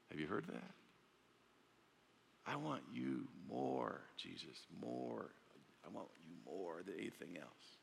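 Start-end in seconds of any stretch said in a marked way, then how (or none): 2.4-7.8 soft, slow, hushed devotion; quiet yearning of David; tender longing. Acted. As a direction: murmur it like a prayer. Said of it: "David in the Psalm says".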